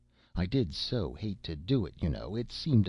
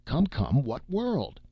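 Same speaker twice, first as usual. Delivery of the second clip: high, louder outburst of impatience; bursting out impatiently; 7 semitones higher, 4 dB louder, slower than usual. A narrator's reading. Clerk pressing a puzzled new arrival, bursting out. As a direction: burst out, pitch climbing on the question. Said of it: "Then he burst out".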